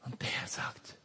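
soft, hushed whisper. Acted. Preacher whispering the Lord's call to the listener, hushed whisper. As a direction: draw them in with a whisper.

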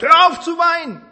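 high, loud scolding. Retold or imitated. God imagined scolding the weeper, a sharp rebuke. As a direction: snap Hör auf zu weinen. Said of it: "dass er schimpft und sagt".

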